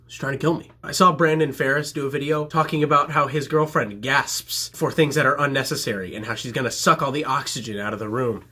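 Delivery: ordinary delivery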